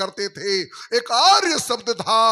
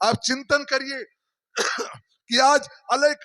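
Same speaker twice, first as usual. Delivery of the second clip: ordinary talk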